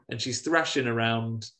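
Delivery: ordinary talk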